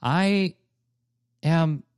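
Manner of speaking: slow, halting declaration of love; heartfelt confession. Acted. Confessing love to Lady Bird, heartfelt. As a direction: confess it word by word, with long pauses. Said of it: "that was very heartfelt".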